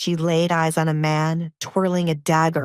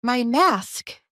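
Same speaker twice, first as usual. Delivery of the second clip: shocked; 6 semitones higher than usual. Acted. Shocked daughter.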